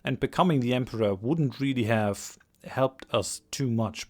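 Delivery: ordinary talk, nothing marked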